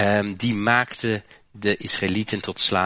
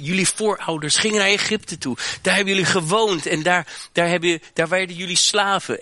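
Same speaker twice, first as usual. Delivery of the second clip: fervent psalmist; 7 semitones higher than usual. Acted. Psalmist reminding Israel of its history, raised fervent preaching. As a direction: call the people back to their roots.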